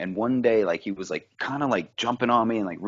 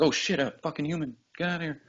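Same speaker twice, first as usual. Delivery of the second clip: voicing the startled bear; 6 semitones higher, 5 dB quieter than usual. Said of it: "He was like".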